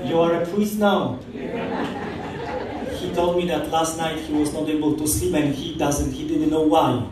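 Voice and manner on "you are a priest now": usual speaking voice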